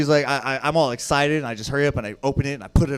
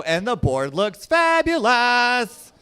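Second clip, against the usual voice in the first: high, slow, drawn-out camp delivery; camp flourish; 6 semitones higher, much slower than usual. Retold or imitated. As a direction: stretch the last word with theatrical flair. Camp retort to queen joke.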